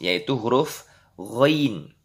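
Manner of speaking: normal talking voice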